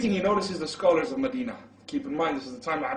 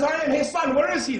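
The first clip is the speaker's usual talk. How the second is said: loud, very high outburst; excited demand; 12 semitones higher, 7 dB louder than usual. Retold or imitated. Excited father looking for his son, urgent. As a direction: cry it out urgently, pitched way up.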